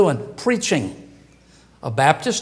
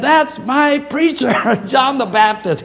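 put-on childlike awe; 6 semitones higher, 3 dB louder than usual. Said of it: "little kids looked up and said".

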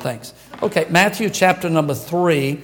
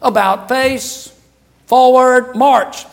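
louder, higher military drill calls; barked drill commands; 6 semitones higher, 6 dB louder, slower than usual. Retold or imitated. Soldier calling drill commands to his troops, barking. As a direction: bark it like a drill sergeant. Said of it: "one soldier said".

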